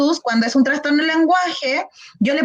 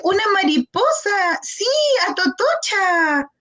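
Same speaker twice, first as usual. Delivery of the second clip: mimicked toddler; 7 semitones higher than usual. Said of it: "Y tú le dices".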